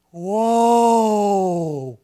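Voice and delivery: long drawn-out wondering whoa; awed whoa. Retold or imitated. Little girl awed by the glory cloud, a long whoa. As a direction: let the whoa stretch out in wonder. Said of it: "And she goes".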